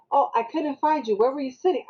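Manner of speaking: retold parent's feeble excuse; excuse-making mother. Other mother makes excuses.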